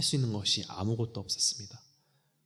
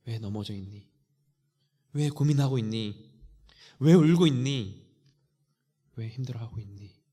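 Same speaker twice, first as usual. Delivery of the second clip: rising plea; 6 dB louder than usual. Acted. God asks struggling believers why.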